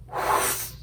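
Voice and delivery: breathy whoosh. A narrator's reading. Narrator showing the giant's breath: airy whoosh. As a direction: blow the word out like wind through a trumpet. Giant blows through the trumpet.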